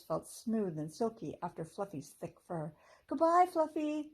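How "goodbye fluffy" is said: high, loud goodbye call. A narrator's reading. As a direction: call it across the meadow. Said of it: "called Rachel".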